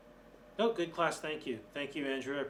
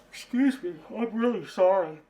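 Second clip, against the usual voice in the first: yawning; 4 semitones higher, 7 dB louder than usual. Real, not acted. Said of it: "I'm yawning tonight".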